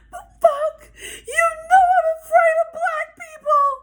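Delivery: loud, very high imitation of a frantic girl; hammed-up hysterical confession; mock panicked wail. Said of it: "She really freaking the fuck out".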